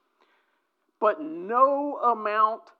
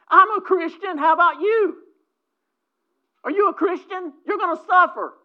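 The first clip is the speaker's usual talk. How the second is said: mock challenger; 9 semitones higher, 7 dB louder than usual. Preacher acting out confrontation.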